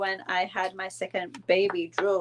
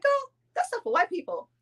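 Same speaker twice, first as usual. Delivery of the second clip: dismissive mom; 11 semitones higher than usual. Retold or imitated. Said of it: "she's like".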